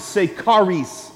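charis said KAH-rees, hard k, stress on first syllable; Greek word charis. Shown as a demonstration. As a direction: model charis slowly as kah-REES for echo. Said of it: "we're gonna learn a Greek word".

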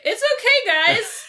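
high, sing-out reassurance; mock reassurance. Frank tells the others the dog is fine, high mock reassurance. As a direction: call it out high to the group.

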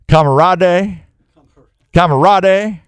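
camarade drawn out as kah-muh-RAH-day, stretched; drawled made-up word. Shown as a demonstration. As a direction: say camarade slowly with a southern drawl. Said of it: "my tree stand camarader".

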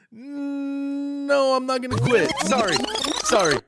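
high, drawn-out "no" into an apology; mock refusal. Fighter backtracking after the backlash, mock refusal.

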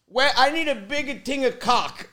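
imitated Italian dad. Dad asking store kid for help, lightly imitated Italian accent. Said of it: "He goes".

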